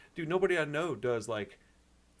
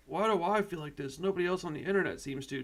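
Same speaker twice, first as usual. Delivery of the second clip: imitating a worried viewer; 7 semitones higher, 5 dB louder than usual. Voicing viewers' inner doubts.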